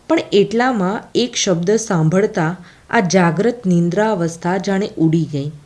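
ordinary delivery

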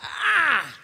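strained "ah" of exasperation; frustrated cry. Acted. Dad venting at his kids, frustrated. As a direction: let out a frustrated yell. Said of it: "It's frustrating".

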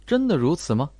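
plain reading voice

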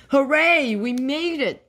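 high, loud opening burst; narrated whoop of relief and joy; jubilant cheer. A narrator's reading. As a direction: cheer in triumph without laughing. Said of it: "laughed Aisha".

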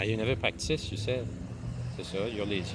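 ordinary talk

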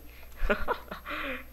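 high, wheezy laugh with little voice; helpless laugh. Real, not acted. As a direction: crack up at your own failure. Se ríe de caerse otra vez. (risa aguda y ahogada)

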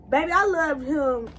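ordinary talk, nothing marked

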